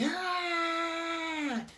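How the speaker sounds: high, soft, sustained wail; long mock wail, held back in volume; imitated baby's cry. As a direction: cry out like a little baby. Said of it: "because the little baby".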